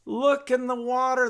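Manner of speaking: loud, high wail; mock crying. Chelm villagers at the well to passers-by, mock crying. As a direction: wail it out in hammed-up tears. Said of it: "and they're crying".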